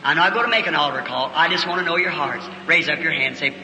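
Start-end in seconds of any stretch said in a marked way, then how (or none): none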